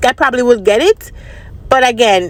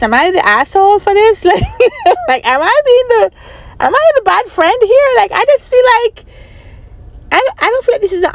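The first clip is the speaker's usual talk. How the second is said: exasperated; 10 semitones higher than usual. Real, not acted. Asking if she is wrong.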